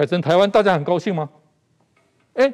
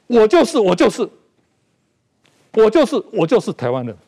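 firm and raised, hammering each 我就是; loud declaration; 4 semitones higher, 4 dB louder than usual. Shown as a demonstration. Commentator on a talk show shouting his identity aloud. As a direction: proclaim it with rising force. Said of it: "我们要大声讲出来".

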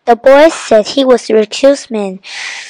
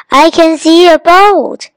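higher, louder call across the water; 6 semitones higher, 5 dB louder than usual. A narrator's reading. Nadim spots a boat from the tree, calling out. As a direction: call out to the others. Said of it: "he called".

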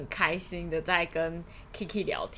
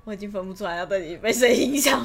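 ordinary delivery

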